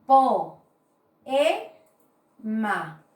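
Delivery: po, e, ma split with a pause between each syllable. Shown as a demonstration. Teacher syllabifying poema for students, pausing between syllables. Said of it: "cuando yo voy a silabiar sería".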